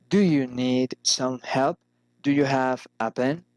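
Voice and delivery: "help" and "pen" each rising; rising intonation. Shown as a demonstration. Teacher models general questions, rising on "help" and "pen". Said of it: "General questions arise in intonation".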